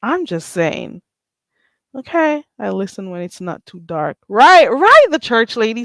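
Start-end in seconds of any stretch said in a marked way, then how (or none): 4.3-5.8 loud, high greeting to the church lady; excited shout-out. Real, not acted. Greeting the church lady in chat, loud and bright. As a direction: call out her name with delight.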